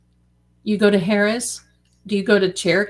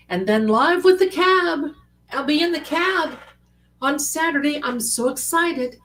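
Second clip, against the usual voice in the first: excited; 8 semitones higher than usual. Real, not acted. Excited about cab trip.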